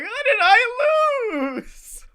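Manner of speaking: high, drawn-out mock wail; crying wrestler. Losing wrestler in the front row, hammed-up crying. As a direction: blubber slowly like a sore loser. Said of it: "guy who's just really crying".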